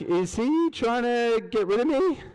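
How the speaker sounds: a hint of worried doubt, slowed down; imitating nervous colleague. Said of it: "She was a little nervous".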